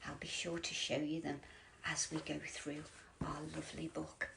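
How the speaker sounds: ordinary delivery, nothing marked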